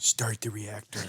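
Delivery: low, soft, slow creature voice; mock mutant voice. Quoting the film mid-chat, low mock mutant. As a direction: intone it slowly like a dying mutant.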